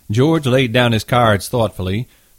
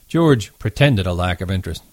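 ordinary delivery

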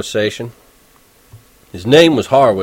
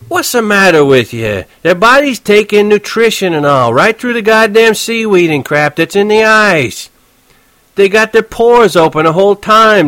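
touchy cabbie; 10 semitones higher, 5 dB louder than usual. Acted. Horwitz explaining fish to Holden, irritated. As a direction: lecture the kid, exasperated.

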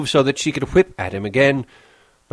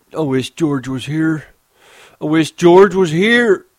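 higher, building to a loud whine; whining; 7 semitones higher, 7 dB louder than usual. Acted. Lenny whines for George, scared. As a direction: whimper like a scared child. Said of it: "Lenny whined".

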